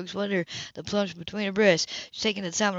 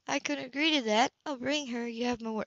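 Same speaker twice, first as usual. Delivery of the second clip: higher, quieter promise; Sybil's voice; 5 semitones higher, 5 dB quieter than usual. A narrator's reading. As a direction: give your word softly.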